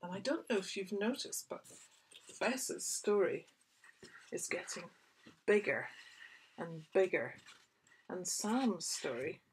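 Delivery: ordinary delivery, nothing marked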